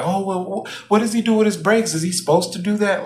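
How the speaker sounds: high, quick tattling voice; tattling girl. Retold or imitated. Daughter asking the other manager about his breaks, high and fast. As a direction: snitch to the boss in a high rush.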